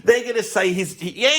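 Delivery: ordinary delivery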